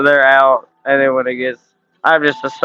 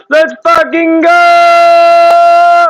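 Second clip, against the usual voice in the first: long drawn-out hype yell, much higher and louder; 14 semitones higher, 6 dB louder, much slower than usual. Real, not acted. Streamer yelling at his chat when a mod drops a like. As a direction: stretch it out slowly and scream it with joy. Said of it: "when you started yelling".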